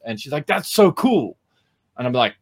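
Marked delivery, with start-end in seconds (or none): 0.5-1.4 higher-pitched imitation of an excited fan; retold enthusiasm. Teacher quoting intern's reaction to trailer, enthusiastic. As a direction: mimic her delighted verdict.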